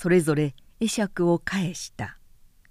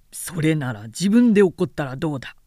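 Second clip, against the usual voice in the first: a stiff, testy retort, slightly lower; stern retainer retorting; 3 semitones lower, 4 dB louder, faster than usual. A narrator's reading. Satomi rebuffing Shichijūrō's teasing, testy and stiff. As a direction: snap it back like a prickly old samurai.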